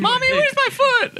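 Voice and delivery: put-on very high, whiny little kid; child voice. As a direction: squeak it like a hurt child. Playing injured child.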